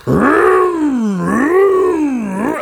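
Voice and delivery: high, drawn-out engine revving noise; revving motorbike imitation. Mocking a guy revving his bike endlessly, drawn-out engine noise. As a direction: vroom like an idling show-off on a bike. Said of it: "he's just going".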